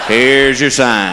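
low, slow, deadpan drawl; slow low deadpan tag line; catchphrase punchline. Acted. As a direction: drop it down slow and dry.

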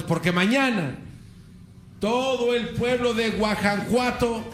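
high, slow orator proclaiming to the people; rally the crowd like a priest-hero; mock heroic proclamation, high and drawn out; rousing speech. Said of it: "se pone a gritar".

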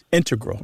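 integral said as IN-te-gral; stress on first syllable. Shown as a demonstration. Host clarifying the mathematicians' form, integral as IN-te-gral. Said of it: "with the stress on the first syllable".

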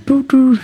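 high-pitched recorder toots; imitated recorder. Musician imitating his first school instrument, high recorder toots. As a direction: toot two high notes like a child's recorder. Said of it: "It's usually the recorder, the flute".

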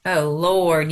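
usual speaking voice

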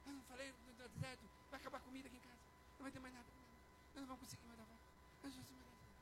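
very soft, trailing worried grumble; muttered complaint. Acted. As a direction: grumble under your breath, fading away. Voices a defeated spouse muttering complaints.

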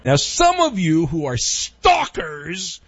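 slow, drawn-out shout on STALKERS; big, stretched-out theatrical address; mock dramatic callout. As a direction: call them out like a hammy announcer.

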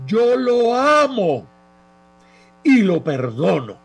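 loud, high, drawn-out shout of forgiveness; impassioned shout, voice thrown high; retold full-force cry. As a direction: cry it out with all your strength. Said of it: "pegué un grito con todas mis fuerzas".

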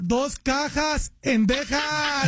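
slow, spelled-out words; drawn-out correction. Retold or imitated. A store clerk correcting the customer, slowly. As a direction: stretch every word slowly for emphasis.

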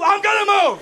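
high, loud exasperated yelling; fed-up driver hollering out the window; angry shout back. Retold or imitated. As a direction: yell back in disbelief.

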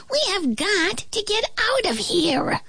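high-pitched plaintive little flea; moaning complaint. A narrator's reading. Trapped flea wants out, high moaning. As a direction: whine it high and desperate. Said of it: "moaned the flea".

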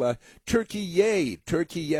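turkey yay, mocking the new way to say Turkey; mock new pronunciation. Mocking host says Turkey the new way, turkey yay. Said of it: "what do we call it now".

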